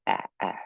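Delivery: ah, a short open vowel; short a sound. Shown as a demonstration. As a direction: demonstrate ah twice. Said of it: "which makes it a short A".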